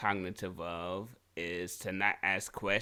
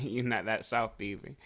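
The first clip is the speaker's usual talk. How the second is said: ordinary talk, nothing marked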